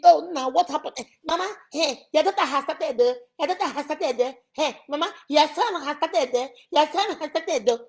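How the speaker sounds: high, nagging complaint; wife running to her mother-in-law with complaints; complaining wife. Retold or imitated. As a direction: whine to the mother-in-law about her son.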